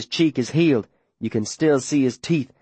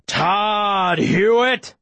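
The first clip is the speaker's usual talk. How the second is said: slow, drawn-out call, pitched up; menacing bellow; 4 semitones higher, much slower than usual. A narrator's reading. Pursuer calling after fleeing Todd, menacing drawn-out bellow. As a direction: stretch the name out as a threat. Enemy shouting in pursuit, closer.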